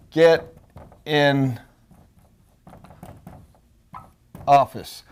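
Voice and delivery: "get", "in", "office" each set apart by long pauses; stressed. Shown as a demonstration. Coach to salespeople, stressing each word, slow and deliberate. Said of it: "I stressed that instead of saying".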